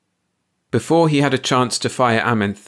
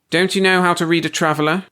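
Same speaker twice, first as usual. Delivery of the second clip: high, louder stern question; a hint of a stern boss; 9 semitones higher, 4 dB louder than usual. A narrator's reading. Boss questioning the worker, sternly. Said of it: "Vogel asked sternly".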